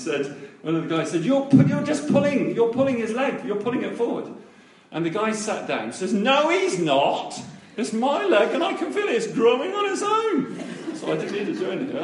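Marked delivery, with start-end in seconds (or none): 1.2-4.3 high, loud protest, easing slightly; heated accusation. Retold or imitated. Boy accusing them of pulling the leg, imitated loud protest. 6.1-10.6 high, loud retort climbing to a squeal; indignant protest. Retold or imitated. Boy insisting his leg is growing, imitated indignant cry.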